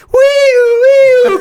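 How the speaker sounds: very high, loud siren wail; imitated siren. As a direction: wail like an approaching tow-truck siren. Imitates the tow truck's siren arriving.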